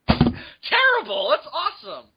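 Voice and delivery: high, drawn-out protest; mock indignation. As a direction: squawk in offended disbelief. Mock offended at remark on accent.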